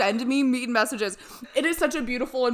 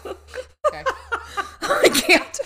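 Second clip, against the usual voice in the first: laughing; 10 semitones higher, 3 dB louder, much slower than usual. Real, not acted. Laughing while trying to stop.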